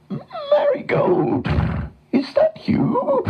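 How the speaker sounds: very high, slow whinnying voice; horse voice. A narrator's reading. Nathan the horse finding his friend, whinnying. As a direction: neigh the words, ending each phrase in a snort.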